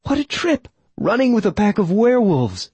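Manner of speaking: put-on high, thrilled young woman's voice; giddy with the thrill of running; excited young woman. A narrator's reading.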